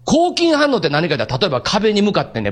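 ordinary talk, nothing marked

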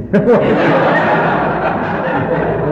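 breathy embarrassed chuckle at himself; sheepish laugh. Real, not acted. Preacher laughing at his fear of mother. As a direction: laugh off the memory of being scared.

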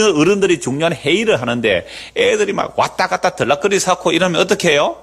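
normal talking voice

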